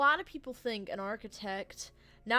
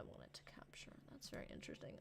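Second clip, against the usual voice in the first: whisper; 23 dB quieter, faster than usual. Real, not acted. Muttering over broken capture.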